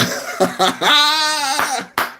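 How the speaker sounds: long high-pitched cackle; high laugh. Real, not acted. Streamer cracking up at a viewer's donation, high laugh. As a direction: burst into a sustained high laugh. Laughs after funny shout-out.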